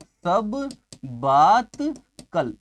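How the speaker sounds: very slow सब... बात... कल, with pauses; slow, broken up. Shown as a demonstration. Teacher building a memory trick, सब बात कल drawn out word by word. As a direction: stretch and separate each word of "सब बात कल". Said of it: "इसको हम जोड़ेंगे".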